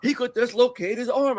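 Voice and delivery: lightly voiced wrestler explaining; imitating George Steele. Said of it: "He said".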